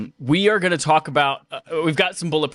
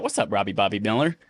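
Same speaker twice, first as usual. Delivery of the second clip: usual speaking voice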